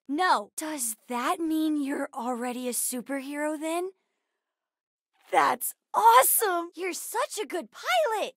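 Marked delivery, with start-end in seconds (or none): 5.3-8.3 high, excited admiration; delighted, high-pitched cheer; thrilled praise. Acted. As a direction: gush at the pilot, voice lifted. Praising the pilot in awe.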